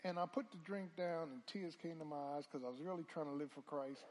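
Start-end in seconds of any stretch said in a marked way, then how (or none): none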